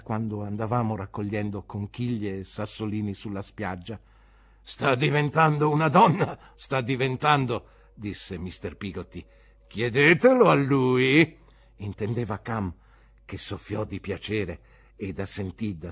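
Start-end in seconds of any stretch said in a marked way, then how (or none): 4.6-7.7 louder, higher seaman voice; cheerful old sailor explaining; hearty fisherman. Acted. Peggotty proud of Emily. 9.7-11.4 louder, higher seaman voice; cheerful old sailor explaining; hearty fisherman. Acted. Peggotty points to Ham.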